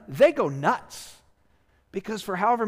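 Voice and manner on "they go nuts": drawn-out, slow, louder go nuts; hammed-up they go nuts; mock excitement. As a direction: stretch it out like a celebrating athlete. Athletes celebrating at Olympics.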